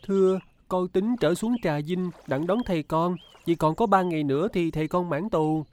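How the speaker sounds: put-on high-pitched boy's voice; boy answering politely. A narrator's reading. Boy telling the lady his plans, high polite voice. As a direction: voice a young boy, pitched well up. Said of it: "Thằng Được trả lời rằng".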